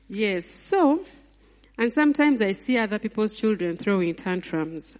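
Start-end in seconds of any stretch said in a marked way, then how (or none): none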